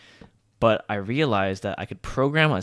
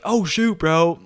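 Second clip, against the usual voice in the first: higher, louder mock dismay; feigned alarm; 7 semitones higher, 6 dB louder, slower than usual. Secret culprit to the base owner, feigned alarm. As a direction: act shocked as if innocent.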